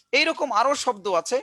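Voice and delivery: ordinary talk, nothing marked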